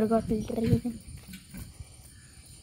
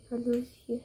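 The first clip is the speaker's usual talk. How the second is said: whisper; 8 dB quieter, faster than usual. Real, not acted. Sleeping cat, showing quietly.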